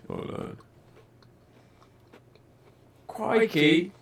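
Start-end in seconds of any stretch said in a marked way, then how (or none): 3.1-3.9 high-pitched mock Australian exclamation; hammed-up Aussie cry, pitched way up; Australian accent. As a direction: try out a playful Australian accent. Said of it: "That's Australian".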